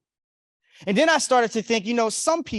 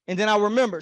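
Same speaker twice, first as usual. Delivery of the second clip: ordinary talk, nothing marked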